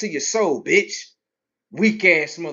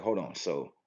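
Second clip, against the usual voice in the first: soft, quiet voice of a thoughtful rich man; a hint of a reflective wealthy man; wealthy person musing; 9 semitones lower, 10 dB quieter than usual. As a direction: mimic a rich guy thinking it over softly.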